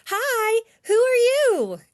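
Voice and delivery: high, loud eager call; a girl calling out to a pteranodon, loud and high. Acted. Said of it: "Annie called to the pteranodon".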